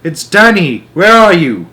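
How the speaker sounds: high, loud desperate call; boy shouting for his lost father. A narrator's reading. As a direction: yell into the darkness. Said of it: "I shouted".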